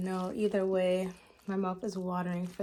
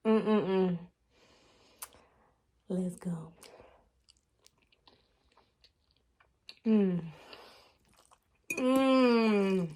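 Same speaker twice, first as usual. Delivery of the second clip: yummy mm; 3 dB louder, much slower than usual. Real, not acted. Tasting the food.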